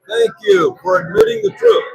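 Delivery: acted grateful reply. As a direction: answer the insult with pointed thanks. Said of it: "I would go like this".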